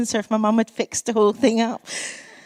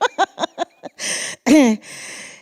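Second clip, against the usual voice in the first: real laugh; 6 semitones higher than usual. Real, not acted. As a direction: burst of high laughter. Laughing at her own joke.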